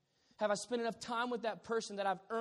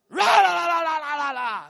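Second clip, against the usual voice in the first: imitated prison-cop yelling, much higher and much louder, fading out; imitating a yell; 10 semitones higher, 22 dB louder than usual. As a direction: bark gibberish like a furious prison guard, then let it die off. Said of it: "yelling ... like a stinking prison cop".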